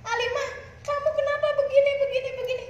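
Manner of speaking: high, fast mock scolding; husband snapping at his wife, mock angry; imitating angry husband. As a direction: rattle it off like a fed-up husband. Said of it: "ketika kemudian dia marah".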